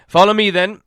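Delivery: a lightly voiced reluctant captain; guard captain. A narrator's reading. Captain relents.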